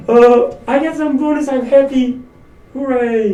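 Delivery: loud, high hooray of a happy employee; mock joy. Employee cheering over a surprise bonus, mock joyful. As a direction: cheer like you just got a bonus.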